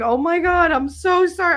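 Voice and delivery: high, gushing apology; apologetic grandmother. Retold or imitated. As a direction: voice grandma fussing sorry.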